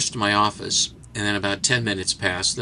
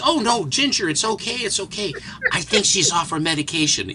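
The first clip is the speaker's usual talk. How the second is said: lightly higher fussy psychologist; reedy psychologist; 8 semitones higher than usual. Retold or imitated. Reedy psychologist calming the woman, lightly higher. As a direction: soothe her in a thin nervous voice. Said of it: "the reedy guy is like this".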